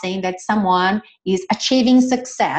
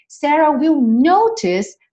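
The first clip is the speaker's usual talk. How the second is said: Sarah will notice read higher and louder, slowly; emphatic reading; 6 semitones higher, 4 dB louder, slower than usual. Shown as a demonstration. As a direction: lift it up for the class. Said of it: "teacher reading model".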